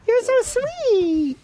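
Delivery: very high, drawn-out coo. Real, not acted. Man greets a friend's baby, adoring high coo.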